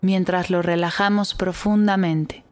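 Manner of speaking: put-on calm guide easing children to relax; guided meditation. Guides a body scan meditation.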